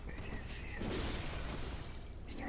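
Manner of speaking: hushed storytelling whisper; whispering on the phone. Acted. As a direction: whisper it, building suspense. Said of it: "Why are you whispering?".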